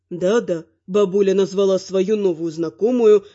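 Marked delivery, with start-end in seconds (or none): none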